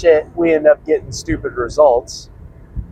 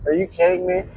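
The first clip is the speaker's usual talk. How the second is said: incredulous sneer; 5 semitones higher, 5 dB quieter, faster than usual. Retold or imitated. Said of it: "Just like".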